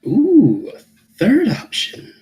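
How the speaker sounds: high delighted ooh; amused ooh. Real, not acted. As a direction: rise up, tickled by it. Amused reaction to her walking out.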